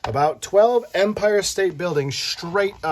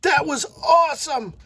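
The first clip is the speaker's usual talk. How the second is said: high, loud joyful cry; a crashed kid whooping with joy; thrilled shout; 12 semitones higher, 3 dB louder than usual. Acted. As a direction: yell it out with pure delight.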